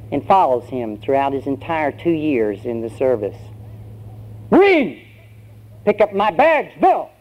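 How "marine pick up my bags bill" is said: loud, high-pitched yelled command; drill sergeant. Acted. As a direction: holler orders like a drill sergeant. Sergeant yells an order.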